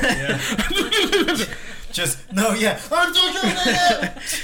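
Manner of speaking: laughing. Real, not acted. Laughing at own audio blooper.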